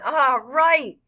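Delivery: high, loud, drawn-out cheer; excited shout. A narrator's reading. Freak shouting at the first big rocket, excited shout. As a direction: shout it out in delight. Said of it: "Freak is shouting".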